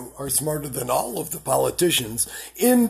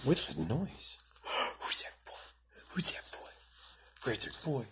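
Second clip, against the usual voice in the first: whispering; 11 dB quieter than usual. Real, not acted. Whispered baby talk to dog.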